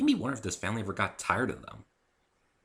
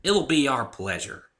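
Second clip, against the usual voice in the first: high, loud country mare twang; bright farm-girl drawl; Applejack voice; 7 semitones higher, 6 dB louder, slower than usual. A narrator's reading.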